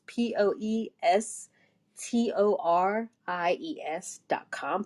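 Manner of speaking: slow, letter by letter spelling; spelled out slowly. Shown as a demonstration. Spelling out the website address.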